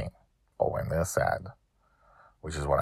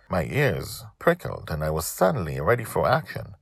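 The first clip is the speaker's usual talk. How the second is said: ordinary delivery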